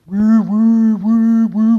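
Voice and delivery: loud, steady woo-woo motor; healthy vacuum whirr. Acted. Speaker imitating a young vacuum cleaner, loud even whirr. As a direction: keep the motor humming strongly. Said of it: "vacuum cleaner is working really well".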